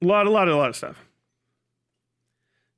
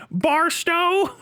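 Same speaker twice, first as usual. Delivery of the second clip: much higher dim desert local, proud of it; 13 semitones higher than usual. Retold or imitated. Hick names his town.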